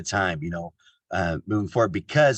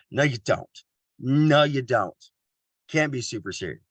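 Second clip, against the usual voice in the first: normal talking voice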